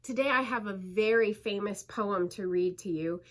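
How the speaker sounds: ordinary delivery, nothing marked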